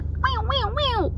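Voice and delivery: high kitten meows; cute high cat voice; playful meowing. Pretend. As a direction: meow like a happy kitten. Playful cat noise heading out.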